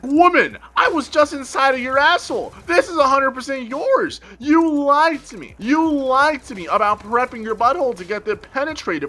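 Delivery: loud, high outraged yelling; shouting at the girlfriend for lying; angry rant. Retold or imitated. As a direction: rant at her, betrayed and disgusted.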